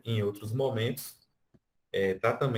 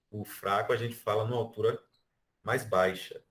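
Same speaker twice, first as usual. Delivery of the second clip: usual speaking voice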